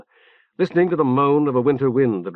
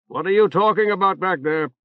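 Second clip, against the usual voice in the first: called out; 7 semitones higher than usual. A narrator's reading. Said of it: "the lieutenant called".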